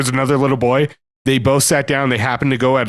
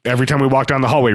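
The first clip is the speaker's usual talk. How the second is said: ordinary delivery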